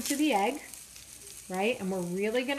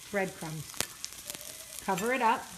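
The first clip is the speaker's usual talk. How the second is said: normal talking voice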